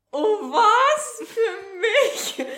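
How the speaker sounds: high, loud, hammed-up gasp of delight; touched surprise. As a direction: overact being moved by an unexpected gift. Own touched surprise re-enacted. (übertrieben gerührt und überrascht)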